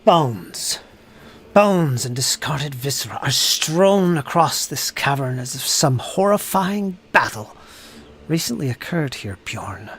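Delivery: dramatic narration with heavy stress and drops; game master's scene setting. Acted. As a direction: set a grisly scene, lingering on key words.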